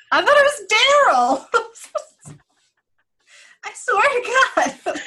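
high laughing squeal. Real, not acted. Laughing at her mishearing.

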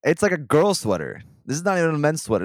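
loud, high, exasperated ranting; indignant rant. Real, not acted. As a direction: vent your outrage at the shop. Ranting about the jacket.

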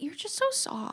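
put-on a fond girlfriend's soft compliment; soft girlfriend quote. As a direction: coo it like an adoring girlfriend. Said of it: "my girlfriend is like".

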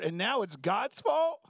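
ordinary delivery

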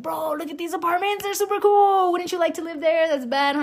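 put-on high, loud hype-up for a child; acted-out excitement. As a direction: gush in a high, loud voice to get a kid excited. Retells hyping apartments to her son.

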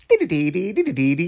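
sing-song mock phone ring; imitated ringtone. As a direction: mimic an electronic ringtone tune. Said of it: "who even have ringtones anymore".

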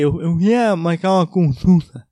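slow, loud, strained nasal-blocked plea; a hint of a sick man on the phone; swollen voice. Calling the clinic receptionist, slow strained voice.

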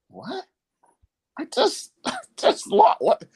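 high, laughing cry of disbelief; can't believe it, laugh it out; disbelieving laugh. Retold or imitated. Told the President is calling after losing, high laughing disbelief.

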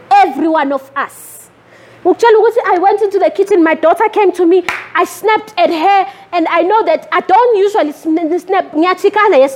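ordinary talk, nothing marked

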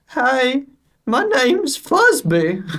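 very high, slow toy voice; high-pitched, drawn-out cuddly-toy greeting; talking teddy bear. Retold or imitated. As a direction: squeak it out slowly like a talking teddy. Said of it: "then he'd be like".

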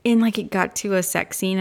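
normal talking voice

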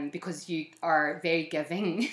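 ordinary talk

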